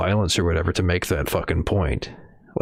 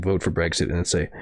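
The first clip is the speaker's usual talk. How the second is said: ordinary delivery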